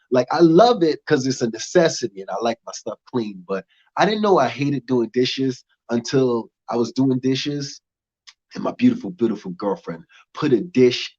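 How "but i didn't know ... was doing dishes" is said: usual speaking voice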